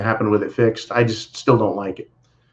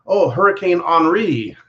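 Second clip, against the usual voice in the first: hammed-up French accent, raised pitch; 6 semitones higher than usual. Host obliging a viewer's request, in a mock French accent. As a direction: put on a playful French accent for Henri. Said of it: "in your best Fre-, French accent".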